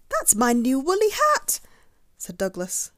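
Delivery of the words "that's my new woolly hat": high, loud, aggrieved cry; upset protest. A narrator's reading. Douglas telling Rabbit it's his hat, upset protest. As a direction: insist on it, pitched right up.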